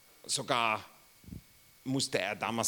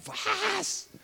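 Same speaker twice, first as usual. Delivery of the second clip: high, stunned "Was?"; surprised. Pretend. Receiving a sports car as a gift, stunned surprise. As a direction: gasp it out in disbelief. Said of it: "Oder wird wir sagen".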